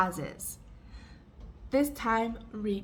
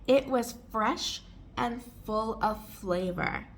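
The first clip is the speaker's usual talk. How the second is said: measured model reading; careful pace for learners to repeat; slower, with pauses. Shown as a demonstration. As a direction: pause before the second half. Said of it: "read it more slowly and take pauses".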